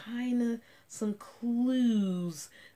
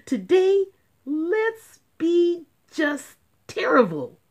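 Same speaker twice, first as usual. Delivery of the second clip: very high, loud mischievous squeal; gleeful; 10 semitones higher, 9 dB louder than usual. Acted. Kids eagerly plotting to misbehave. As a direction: squeal it like excited naughty kids.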